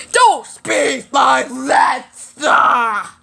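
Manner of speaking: slow, loud, high scolding shout; angry scolding. Acted. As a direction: yell it out like a strict teacher. Scolding a rude student.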